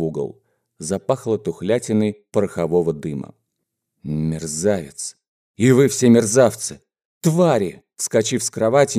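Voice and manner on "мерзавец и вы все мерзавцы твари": ordinary delivery, nothing marked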